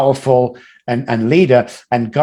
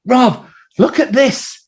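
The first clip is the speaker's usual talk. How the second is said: friend's excitement; 9 semitones higher, slower than usual. Retold or imitated. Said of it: "he went".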